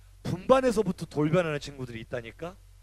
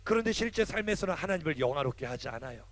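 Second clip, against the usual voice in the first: normal talking voice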